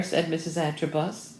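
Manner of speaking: ordinary delivery, nothing marked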